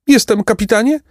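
tiny squeaky bandit voice; thin high voice. A narrator's reading. Scrawny bandit answers his captain, thin high voice. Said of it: "zawołał cieniutkim, wysokim głosem".

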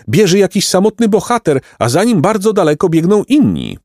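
high, excited spectator cry; maidens exclaiming. A narrator's reading. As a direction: report the race in a thrilled high voice. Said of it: "zakrzyknęły dziewice".

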